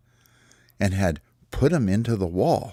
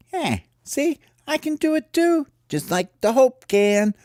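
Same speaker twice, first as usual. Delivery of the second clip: boastful high bird; 3 dB louder than usual. Acted. Ollie brags to the others he matches Hope, cocky high voice.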